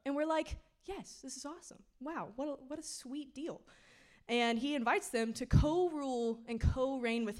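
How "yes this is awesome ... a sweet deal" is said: softer, higher mock reaction of delight; quietly impressed, pitched up; hushed awe. Speaker voices our reaction to God's offer, in hushed awe.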